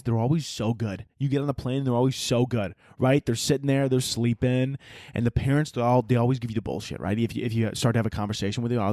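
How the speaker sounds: ordinary talk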